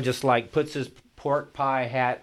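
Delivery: ordinary delivery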